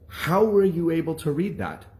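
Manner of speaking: high, incredulous imitated outburst; shocked anger. Teacher grilling the poor reader, shocked and angry. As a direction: demand it in angry disbelief, pitch up. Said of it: "pissed, shocked, and angry".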